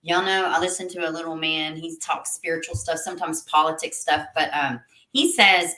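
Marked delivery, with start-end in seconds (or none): none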